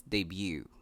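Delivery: "debut" as day-BYOO; y glide in "debut": day-byoo; correct pronunciation. Shown as a demonstration.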